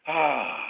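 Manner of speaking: loud released sigh on the out-breath; audible sigh. Shown as a demonstration. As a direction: let it all go in one sigh. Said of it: "let it out with an audible sigh".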